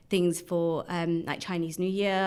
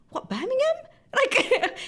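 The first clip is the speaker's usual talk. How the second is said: much higher, louder, disbelieving "What, Birmingham?"; incredulous; 5 dB louder, slower than usual. Retold or imitated. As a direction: squeak it out in baffled disbelief. Her baffled reply to racist.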